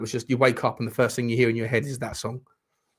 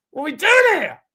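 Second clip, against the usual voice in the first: exasperated shout; 7 dB louder than usual. Real, not acted. Frustrated at team.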